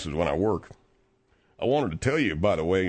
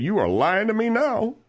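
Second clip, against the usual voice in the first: high-pitched indignant retort; indignant accusation; 13 semitones higher than usual. Retold or imitated. Retelling how he accused Cliff of lying, indignant. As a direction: call out the lie, voice pitched way up.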